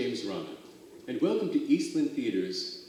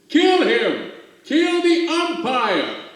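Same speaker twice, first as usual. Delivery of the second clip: high, loud furious yell, slow on the umpire; angry shout; 13 semitones higher, 12 dB louder, much slower than usual. Acted. Fan in the stands yells at umpire, furious shout. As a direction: scream it from the stands. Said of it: "shouted someone on the stand".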